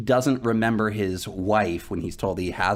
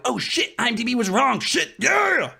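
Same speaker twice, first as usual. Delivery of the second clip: excited outburst; 13 semitones higher than usual. Retold or imitated. Podcaster reliving a surprise cameo, thrilled high shout. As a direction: blurt out shocked delight. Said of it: "I'm like".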